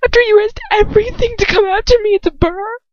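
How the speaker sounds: high, loud, trembling plea; a girl's voice close to tears. A narrator's reading. Girl confronting her lover, high and trembling. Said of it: "a trembling flutter of a whisper".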